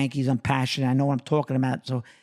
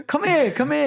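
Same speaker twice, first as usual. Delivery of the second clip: imitating Jeter; 9 semitones higher than usual. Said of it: "He goes".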